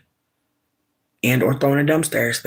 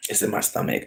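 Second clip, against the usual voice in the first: whispered; 7 dB quieter than usual. Real, not acted. Calming down after anger.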